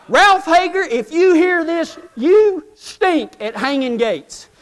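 high, slow mock scolding; teasing shout-out to his friend Ralph about gates; teasing call-out. Real, not acted. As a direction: call him out by name, teasing.

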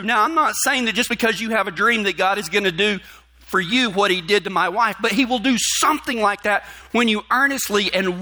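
high, fervent preacher voice; preaching. Acted. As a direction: preach it out to the congregation. Preaches sermon, rising intensity.

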